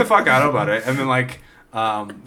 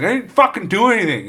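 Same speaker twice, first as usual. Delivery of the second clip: high, raised-voice denial; mock indignant protest; 8 semitones higher, 4 dB louder than usual. Driver thrown in police car, imitated indignant protest. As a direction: protest like a hammed-up arrested man.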